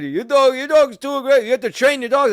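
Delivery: high, loud mocking scold; scolding dog owner. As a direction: mimic the owner's shrill complaint. Mimics the other dog owner.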